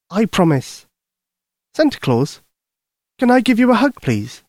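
put-on high, eager young girl; little girl voice. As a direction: speak like a worried little girl. Lucy asks.

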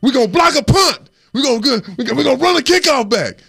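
rally the team like a coach; imitating a coach's hyped speech; fired-up coach. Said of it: "he said".

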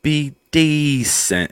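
put-on very slow, stretched English accent; drawn-out English accent. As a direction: stretch each vowel in an imitated English accent. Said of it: "they'll extend a word".

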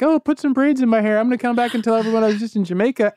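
a jerk tourist gushing about his braids and Jamaica; obnoxious tourist. Pretend. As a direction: play the clueless vacationer showing off. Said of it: "Like some jerk on vacation".

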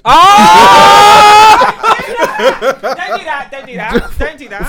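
loud, high, laughing shock; laughing protest. Real, not acted. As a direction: shriek it out through laughter. Shocked at being teased.

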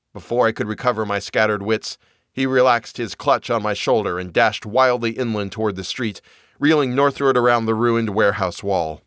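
ordinary delivery

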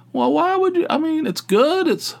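squeaky high-pitched imitation of the pushy waitress; high mock waitress. Telling a pushy waitress to eat the pie, mock high voice. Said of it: "She goes".

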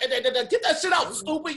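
high, fast mimicked stammer into a jeer; mock their stutter fast, then dismiss them; mocking heckler. Somebody jeering at a stutterer, high mocking put-down. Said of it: "somebody was like".

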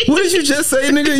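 high, indignant what-did-you-say; mock outrage. Real, not acted. Responding to his friend's joke, mock indignation. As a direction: act offended, half joking. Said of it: "What, what".

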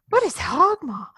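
high, loud imitation of disbelief; mock startled question. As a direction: blurt it out in mock alarm. Imitating a startled, choking reaction.

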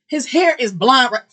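high, loud excited gush; excited squeal. Real, not acted. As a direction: squeal over the photo. Gushing over celebrity photo.

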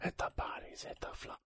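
whispering instead of screaming; whispered lyrics. Pretend. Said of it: "I don't wanna scream".

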